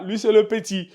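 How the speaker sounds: ordinary talk, nothing marked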